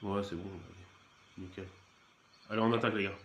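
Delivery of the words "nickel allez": normal talking voice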